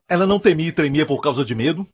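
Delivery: ordinary delivery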